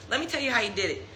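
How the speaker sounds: ordinary talk, nothing marked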